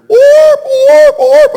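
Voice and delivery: high, loud yelping orp sounds; imitated dog yelp. The dog yelping after hitting the wire, high yelps. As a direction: squeal like a dog that just got hurt. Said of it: "suddenly I heard".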